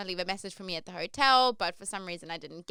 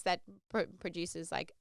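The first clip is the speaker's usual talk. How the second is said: usual speaking voice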